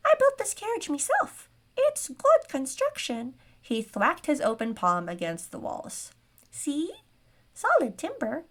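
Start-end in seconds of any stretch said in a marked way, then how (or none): 0.1-3.3 very high, louder indignant squeak; scoffing gnome. A narrator's reading. Gnome defending his own building work to the buyer, indignant squeak. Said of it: "The gnome scoffed at her". 6.5-8.5 high-pitched little gnome proving a point; squeaky gnome. A narrator's reading. Gnome thwacking the wall for his buyer, high insistent squeak. Gnome proves carriage is sturdy.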